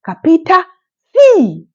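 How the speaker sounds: high, loud mock Italian demand; mocking the nun. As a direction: snap it like the arrogant nun.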